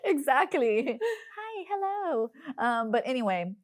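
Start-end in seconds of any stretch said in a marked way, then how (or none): 1.4-2.3 bright, much higher sing-song greeting; customer service voice. Shown as a demonstration. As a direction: greet like a front desk. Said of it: "Turn on your customer service voice".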